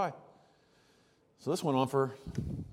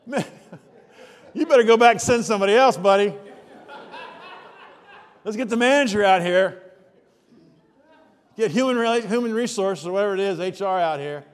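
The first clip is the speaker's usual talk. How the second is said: loud, higher, scoffing send-off; mock dismissive shout; 5 semitones higher, 10 dB louder than usual. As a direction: brush the guy off loudly. Preacher voices customer's brush-off.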